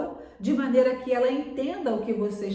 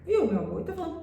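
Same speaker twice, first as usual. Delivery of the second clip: acted soothing mother; tender mother; 8 semitones higher, much faster than usual. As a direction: gently persuade your husband. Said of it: "ela falou".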